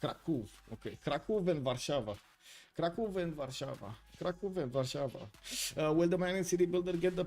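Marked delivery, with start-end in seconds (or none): 0.0-5.3 "Kraków" as KRA-koof, "Warszawa" as var-SHA-va; Polish pronunciation. Shown as a demonstration. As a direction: practise Polish "Kraków" and "Warszawa" after a model. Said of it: "listen to how it will be in Polish".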